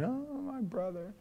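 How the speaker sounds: high, slow, soft mock wail; reenacted tearful lament; imitated crying. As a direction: draw it out like someone weeping on the phone. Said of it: "And he's crying".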